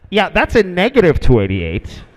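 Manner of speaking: mildly irritated refusal; a hint of an annoyed controller. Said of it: "Like he was getting annoyed".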